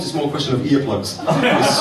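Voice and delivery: ordinary talk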